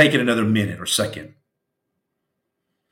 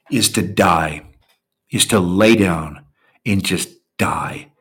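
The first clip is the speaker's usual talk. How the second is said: normal talking voice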